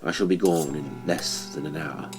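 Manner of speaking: plain reading voice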